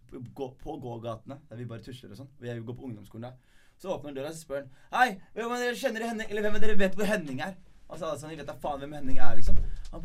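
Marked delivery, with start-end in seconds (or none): 4.9-7.5 demanding stranger. Retold or imitated. Gunman from car demanding Henning. 8.3-9.6 loud, cheeky reply to the stranger; loud cocky retort. Retold or imitated. Boys retort to armed stranger.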